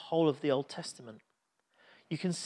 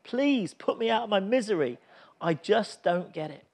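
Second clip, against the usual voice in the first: broken despairing prayer; 6 semitones higher, 5 dB louder than usual. A narrator's reading. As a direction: cry out to God, defeated and begging. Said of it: "Finally broken and defeated, he prays".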